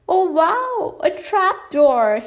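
high, slowed, amazed exclamation; delighted. Acted. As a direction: marvel at the discovery. Moose delighted discovery.

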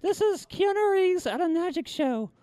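high puppet voice; puppet announcer. Acted. Puppet sets up his next impression for listeners, high voice. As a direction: announce the bit in a high, small voice.

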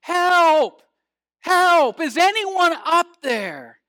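high, loud cry for help; desperate shout. Acted. Hanging man calling up the cliff for help, desperate shout. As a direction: yell out in desperation, stretching the last words. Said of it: "the man shouted in desperation".

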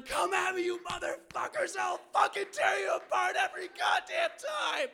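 high, full-throated challenge, words stretched out; taunting yell. Retold or imitated. Traveler taunting the dogs that attacked him, furious full yell. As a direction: roar threats at a retreating enemy. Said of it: "Taunting the dogs, yelling".

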